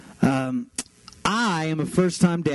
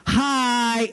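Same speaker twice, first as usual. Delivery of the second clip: long, high kiddie hi; lightly voiced toddler greeting every passer-by; imitated toddler greeting; 7 semitones higher than usual. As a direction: sing out a higher, child-like greeting. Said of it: "he says".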